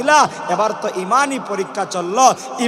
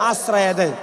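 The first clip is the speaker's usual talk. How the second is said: usual speaking voice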